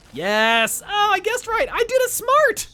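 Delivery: high, loud burst of real triumph; real excited celebration; triumphant excitement. Real, not acted. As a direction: crow over a lucky guess, loud and high. Celebrates guessing right.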